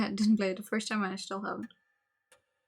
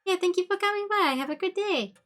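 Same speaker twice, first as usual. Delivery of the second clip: much higher singsong thanks; sarcastic farewell; 10 semitones higher than usual. Real, not acted. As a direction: wave him off sarcastically.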